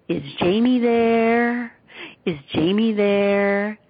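put-on slow French accent, drawn-out "there"; a French voice stretching "there" slowly and eerily; distant French-accented voice asking slowly; French-accented ghost voice. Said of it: "the voice had an accent, and it extended the word there".

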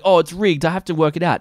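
high, loud imitated grumbling customer; mock complaint. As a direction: mimic a suspicious punter protesting.

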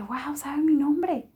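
high, slow, half-whispered wonder; amazed. Retold or imitated. Recalling her thought when the girl knew her name, hushed amazement. Repeats her astonished inner reaction.

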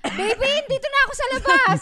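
high, loud mock call from outside. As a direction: call out to a partner through the bathroom door. Acts partner calling from outside shower.

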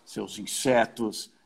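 slow, pompous villain sneer; Vegeta voice. Retold or imitated. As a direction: drag it out like an overblown hammy villain. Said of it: "falando com a voz um pouco mais empolada".

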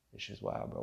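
breathy hushed aside; whispered. Real, not acted. Vlogger repeating to himself in a whisper. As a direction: let it fade to a whisper.

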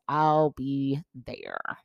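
each word drawn out slowly; slow emphatic. Retold or imitated. Retelling her promise to a grieving friend, slow and deliberate. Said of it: "comes flying out of my mouth".

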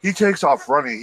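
ordinary delivery

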